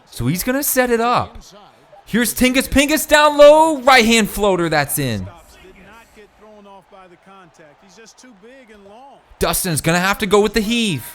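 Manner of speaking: energetic esports play-by-play; esports caster. Acted. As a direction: call it live as the play builds. Live play-by-play run.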